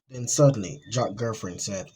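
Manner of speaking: plain reading voice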